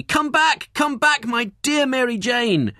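theatrical high, loud cry of grief; grief-stricken shout. A narrator's reading. Husband shouting to his wife's body, theatrical grief. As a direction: wail it out loud and very high, drawing out the last words. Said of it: "demonstrated his grief by shouting to the body".

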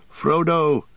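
high, drawn-out hail; mild shout for a lost friend; calling out. A narrator's reading. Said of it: "panting and calling".

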